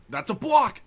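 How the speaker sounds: loud, high, triumphant yell; excited shout. Real, not acted. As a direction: shout the block out in pure excitement. Defending a shot in-game.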